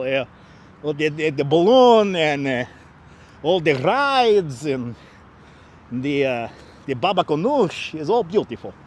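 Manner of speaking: put-on effortful comic Russian accent; Russian accent with stretched stressed words. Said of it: "work harder doing this accent".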